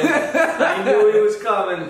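drawn out with a laugh; laughing excitedly. Real, not acted. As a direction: stretch it slowly through laughter. Repeats it laughing.

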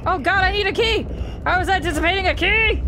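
fast, high and loud panic; panicking gamer. Real, not acted. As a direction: rattle it off in a panic. Frantic gamer spotted.

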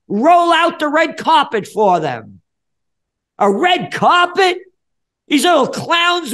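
high, loud, drawn-out indignant rant; outraged shouting. Real, not acted. Ranting about honoring politicians with red carpets, outraged shouting. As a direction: shout it in outrage, stretching the words.

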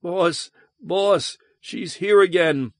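high-pitched, frightened voice; terrified. A narrator's reading. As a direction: cry out a warning in terror. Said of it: "terror rattling in his throat".

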